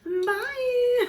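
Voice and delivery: very high, loud bye; high squeaky bye. Real, not acted. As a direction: squeak out the goodbye. Sign-off after tearful goodbye.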